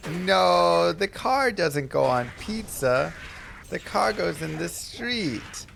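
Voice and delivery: put-on high, drawn-out small child voice; mock toddler scolding, words stretched out; little kid lisp. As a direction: imitate a lisping kid, slowly and insistently. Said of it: "It's more just like the little kid lisp".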